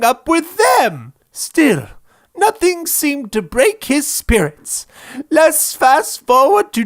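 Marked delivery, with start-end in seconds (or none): none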